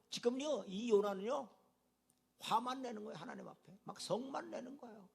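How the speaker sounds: normal talking voice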